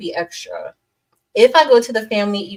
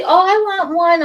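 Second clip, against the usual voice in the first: high-pitched, louder mimicry; imagined eager requester; 10 semitones higher, 5 dB louder than usual. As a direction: mimic someone begging for one, pitched way up. Voices an imagined viewer's request.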